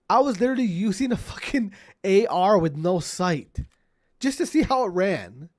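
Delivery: loud rant. Real, not acted. Ranting at a kid in chat.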